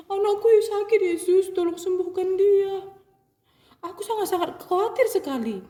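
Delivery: high, loud worried father; father asks Jesus to heal his child, high and pleading. Acted. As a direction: beg urgently, pitch up high. Father begs again.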